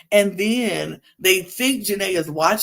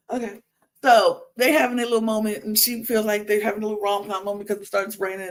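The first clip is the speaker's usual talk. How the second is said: normal talking voice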